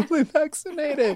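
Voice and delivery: high laughing repeat. Real, not acted. Teasing repeat of friend's words.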